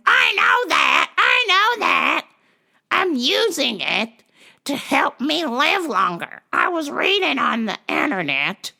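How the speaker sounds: hammed-up stubborn caller; high old-lady voice insisting, slowed; mock shrill old lady.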